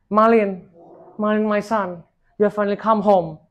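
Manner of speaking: high and loud, calling his name with joy; joyful cry out. A narrator's reading. Mother calling to her son on the ship, joyful loud cry. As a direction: call to the long-lost son, overjoyed. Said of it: "she cried out joyfully".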